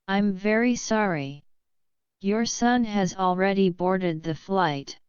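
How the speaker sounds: ordinary delivery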